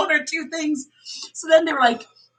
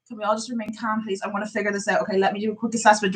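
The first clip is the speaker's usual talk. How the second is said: softer, lower soothing plea; calming voice; 4 semitones lower, 6 dB quieter, faster than usual. Retold or imitated. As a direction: settle panicking residents gently. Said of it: "like, calming voice".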